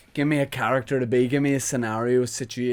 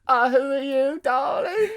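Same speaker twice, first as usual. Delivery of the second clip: camp high voice; 13 semitones higher than usual. Acted. Camp character voice.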